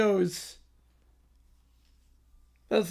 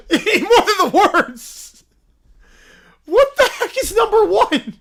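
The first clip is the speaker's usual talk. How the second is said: loud and high through laughter; laughing disbelief; 10 semitones higher, 7 dB louder than usual. Real, not acted. Streamer laughing at the worst anthem, loud and high. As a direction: talk while cracking up.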